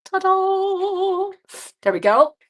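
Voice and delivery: high, bright ta-da reveal; excited planning. Real, not acted. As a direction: show it off, delighted. Showing finished card.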